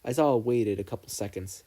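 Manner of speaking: plain reading voice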